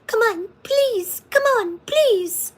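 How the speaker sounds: high, slow pleading dog voice. Retold or imitated. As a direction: voice the bored dog begging for attention. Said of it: "he's like".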